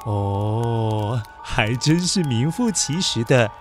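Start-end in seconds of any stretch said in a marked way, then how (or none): none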